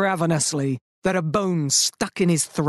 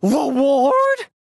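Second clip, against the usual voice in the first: loud incredulous cry; indignant wolf outburst; 8 semitones higher, 4 dB louder than usual. Acted. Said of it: "cried the wolf".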